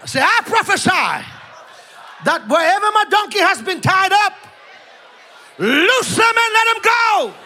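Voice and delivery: very high, shouted declarations phrase by phrase; rousing preacher's cry, pitched high; full-force preaching. Real, not acted. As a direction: lead the congregation in a loud proclamation.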